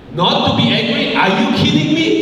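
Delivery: a frustrated wife snapping at her idle husband; exasperated outburst. Retold or imitated. Frustrated wife, husband watches football.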